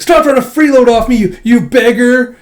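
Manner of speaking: loud, high, slowing on beggar; angry yell. Retold or imitated. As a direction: bark the insult at her. Damien yelling at Anya.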